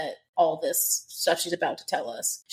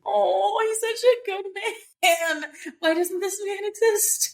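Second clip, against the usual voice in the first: high, slow lament over a fictional hero; a half-playful swoon of sadness; sad and moved; 10 semitones higher, slower than usual. Real, not acted. Reader moved by Cassian's kindness, sadly swooning over him. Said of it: "I'm so sad".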